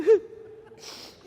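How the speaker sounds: high, loud fake wail; husband fakes grief over his dead wife; mock sob. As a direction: ham up a grieving sob. Widower cries theatrically.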